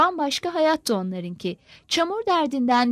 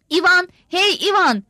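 higher, louder call across the dark room; 6 semitones higher, 5 dB louder than usual. A narrator's reading. Old father calls out to his son Ivan, loud and high. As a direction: shout the son's name to wake him. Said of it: "diye bağırmış".

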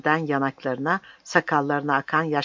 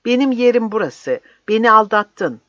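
loud, high-pitched reproach; indignant raised-voice outburst; angry accusation; 7 semitones higher, 8 dB louder than usual. Acted. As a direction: stand your ground and accuse him. Priest accuses the captain.

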